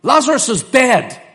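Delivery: loud, high, firm Lazarus is dead; forthright proclamation. Retold or imitated. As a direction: announce it boldly, no hedging. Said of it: "That's the way he says it".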